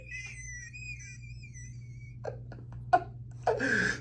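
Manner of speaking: high, wheezy laugh trailing on; long helpless laugh. Real, not acted. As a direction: laugh till the breath runs out. Laughs after his punchline.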